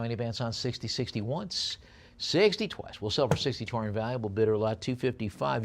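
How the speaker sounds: ordinary talk, nothing marked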